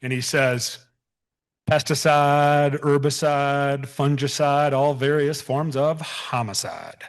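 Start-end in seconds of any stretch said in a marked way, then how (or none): 1.7-7.0 slow, drawn-out Southern accent imitation; lightly put-on Southern drawl, savoring each word. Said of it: "He's got this unbelievably thick Southern accent".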